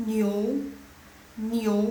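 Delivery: "New" said ni-ou, loud and drawn out; O in the middle. Shown as a demonstration. Teacher asks if O is heard, clear ni-ou. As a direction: let the O sound inside "New". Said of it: "seperti ada O di tengahnya".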